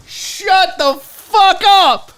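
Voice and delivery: high, loud, drawn-out amazement; loud disbelief. Real, not acted. Unboxer reacting to a gift, loud disbelief. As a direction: belt it out slowly in delighted shock. Repeats it louder on seeing gift.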